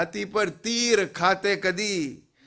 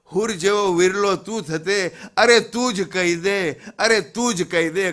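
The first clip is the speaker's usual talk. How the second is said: quick declaimed questions, building louder; impassioned poem recitation; 5 dB louder, faster than usual. Acted. Preacher challenges listener to compare with martyrs, impassioned recitation. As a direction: press the listener for an answer, ever more forcefully.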